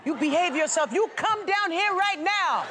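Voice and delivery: yelling across the room. Real, not acted. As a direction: call him down, sternly. Host scolds guest about to jump.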